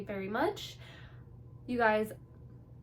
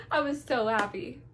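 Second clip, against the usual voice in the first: ordinary delivery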